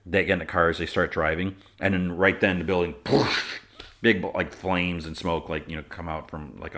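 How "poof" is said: noisy "poof" of a blast; imitated explosion. Recapping the building going up, imitated blast. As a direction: make the explosion noise with your mouth.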